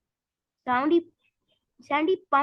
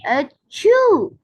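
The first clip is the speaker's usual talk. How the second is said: sudden high loud achoo; loud sneeze; 7 semitones higher, 8 dB louder than usual. A narrator's reading. SpongeBob sneezing alone with Gary, loud sneeze. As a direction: blast out a sneeze.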